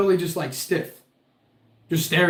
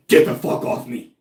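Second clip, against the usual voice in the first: harsh, voiceless mock-evil voice; imitated evil turn; 5 semitones lower, 4 dB louder than usual. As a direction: throw it out as a sudden possessed-sounding rasp.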